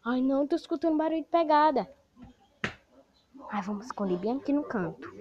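normal talking voice

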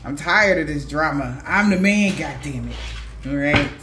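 fed up, putting a foot down; acted weary defiance, punching the key claim; fed-up refusal. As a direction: voice someone who has had enough. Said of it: "somebody definitely is like".

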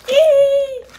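sharp high squeal. Real, not acted. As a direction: squeal at the taste. Reacting to candy.

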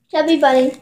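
talking through stuffed cheeks; mouth full. Real, not acted. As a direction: say it round a mouthful of marshmallows. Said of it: "we're each gonna have a marshmallow in our mouth".

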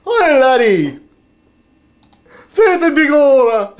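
high, loud stage-Irish calls; mock Irish accent. Vlogger playing a stage Irishman for a subscriber, loud hammed-up Irish. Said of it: "pardon my Irish accent".

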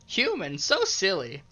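high sprite voice. Acted. Voicing the green sprite.

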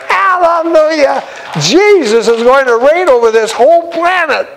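exultant shouted praise; joyful shout. Real, not acted. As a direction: cry out praise with joy. Preacher's joyful outburst.

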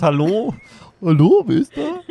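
high, loud laughing voice; mock the ghost answering the door. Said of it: "Er antwortet".

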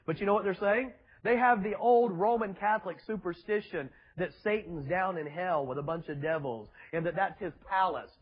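ordinary talk

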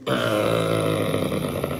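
low, drawn-out growl; sudden mock monster growl. Sweet fish suddenly turns fierce.

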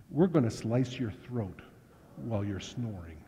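soft, menacing low threat; quiet threat. Retold or imitated. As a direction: drop it to a deadly soft warning. Bikers threatening to kill Rob.

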